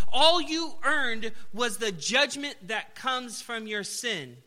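slow, loud sermon line; emphatic preaching. Acted. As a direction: weigh each word for the listeners.

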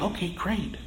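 unimpressed, under the breath; a quiet mock okay great; dry sarcastic reply. Unimpressed reply to fake tears.

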